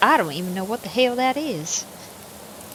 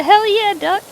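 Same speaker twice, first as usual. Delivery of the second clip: loud, high whoop of joy; high, loud hell yeah; excited cheer; 12 semitones higher, 9 dB louder than usual. Real, not acted. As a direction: cheer it out, thrilled.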